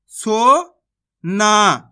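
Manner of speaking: slow, stretched सोना, each sound held long; teacher drawing out सोना slowly; drawn-out demo word. Shown as a demonstration. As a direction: stretch सोना out for the class to hear. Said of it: "तो मिलके बन जाएगा".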